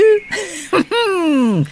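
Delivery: high delighted giggle at his own joke; bright little laugh. Real, not acted. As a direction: giggle at the rooster gag.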